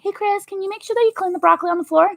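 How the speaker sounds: high, quick, sugary ask; sweet tone. Retold or imitated. Wife sweetly asking Chris to clean broccoli, high. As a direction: ask nicely, light and bright. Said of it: "your tone of voice".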